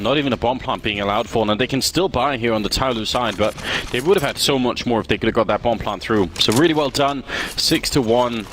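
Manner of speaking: ordinary talk, nothing marked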